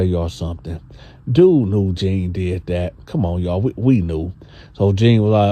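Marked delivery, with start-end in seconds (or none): none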